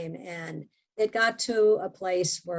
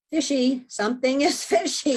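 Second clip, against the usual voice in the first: suspicious; 6 semitones higher, 4 dB louder than usual. Real, not acted. Suspicious inner thought, laughing.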